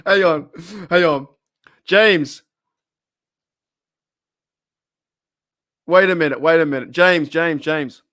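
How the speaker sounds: loud excited calling out to a viewer. Real, not acted. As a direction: shout to get James's attention.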